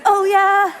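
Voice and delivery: high, loud mock-bright oh yeah; sarcastic brush-off. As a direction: toss it off with fake cheer. Dismissive reply to husband.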